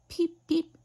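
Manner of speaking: chick peeping. A narrator's reading. Chick peeps to the mare.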